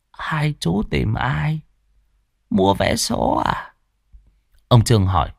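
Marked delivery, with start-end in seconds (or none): 0.1-3.7 put-on weak old voice. A narrator's reading. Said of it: "giọng nói yếu ớt".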